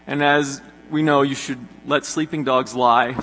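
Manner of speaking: normal talking voice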